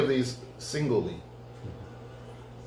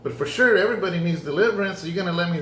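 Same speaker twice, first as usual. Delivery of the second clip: much higher, eager and pleading; excited pleading; 6 semitones higher than usual. Retold or imitated. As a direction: burst out with hope that God finally agrees. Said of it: "And I'm like".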